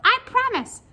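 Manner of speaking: high, loud squeaky creature; loud high-pitched cartoon voice; little pigtail voice. A narrator's reading. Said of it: "said the pigtail".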